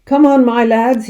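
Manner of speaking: loud, high rallying cry; rally the troops with gusto; cried out. A narrator's reading. Sergeant rallying the men to chase pheasants, loud rallying cry. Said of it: "he cried".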